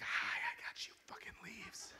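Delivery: unvoiced whisper with a sneaky laugh; gloating whisper. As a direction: whisper in spiteful triumph.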